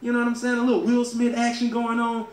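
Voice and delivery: ordinary talk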